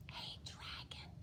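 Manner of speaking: soft whisper; whispered softly. A narrator's reading. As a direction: barely breathe it into his ear. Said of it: "Elizabeth whispered very softly".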